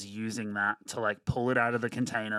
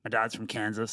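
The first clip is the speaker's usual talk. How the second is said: ordinary talk, nothing marked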